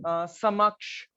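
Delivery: समक्ष stretched out slowly; slow example word. Shown as a demonstration. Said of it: "इसके बाद हम देखेंगे ऐसे ही है रिक्शा". (समक्ष धीरे बोलना)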